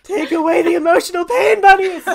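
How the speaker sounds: loud, high, hammed-up wail of relief; building to a shouted plea; melodramatic cry. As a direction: cry out to the bunnies with full drama. Escher cries out under the bunnies.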